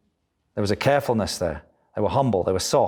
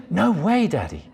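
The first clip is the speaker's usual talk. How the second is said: put-on child voice; 9 semitones higher than usual. Child voice in retold story.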